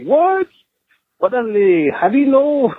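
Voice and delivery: very high-pitched retold disbelief; acted astonishment. Caller reacting to being recognized by a stranger, acted astonishment. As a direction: act startled, voice jumping way up.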